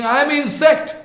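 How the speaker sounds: high, pleading self-abasement, imitated; Haridas Thakur crying. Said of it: "Haridas Thakur crying and speaking".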